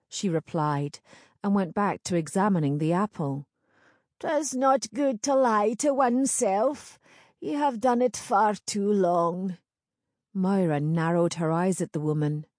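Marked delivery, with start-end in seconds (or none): 4.2-9.6 put-on higher-pitched old crone; knowing old village crone. Crone character voice.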